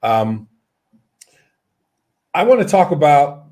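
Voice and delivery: ordinary talk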